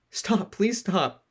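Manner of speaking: high, slow begging; desperate plea. Acted. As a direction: drag out the plea.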